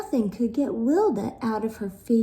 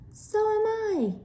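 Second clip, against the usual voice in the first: high-pitched sister yelling; high mock yell; 13 semitones higher than usual. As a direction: yell back in a much higher voice. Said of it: "yelled Gilda".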